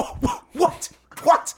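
high, breathless disbelief; surprised. Real, not acted. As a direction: react in shock. Shocked reaction to LeBron opinion.

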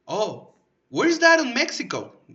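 American caller confused about Colombia, slow and high; clueless customer. Retold or imitated. Clueless gringo customer asks.

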